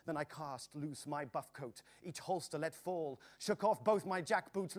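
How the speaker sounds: ordinary delivery, nothing marked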